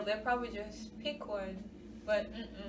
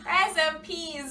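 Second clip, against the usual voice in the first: slow, louder, higher Rice and peas; emphatic correction; 5 semitones higher, 6 dB louder, slower than usual. Shown as a demonstration. Insisting on the true Jamaican dish name, slow and loud. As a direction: drag out Rice and peas as the only right name. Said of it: "Ain't nobody talking about no rice and beans".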